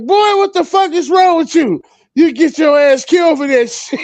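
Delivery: high, loud furious mother; angry mom yell. Retold or imitated. Mom yells at her son selling oregano, furious and high.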